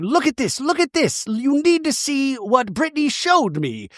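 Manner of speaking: high, eager imitation; excited urging. [name] showing her friend what Brittany showed her, excited. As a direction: imitate someone urgently showing off a find.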